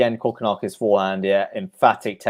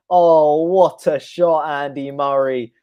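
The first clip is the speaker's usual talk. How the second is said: high, loud, thrilled cry; a commentator losing it over a great shot; excited outburst; 5 semitones higher, 6 dB louder, slower than usual. Real, not acted. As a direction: burst out at a stunning winner.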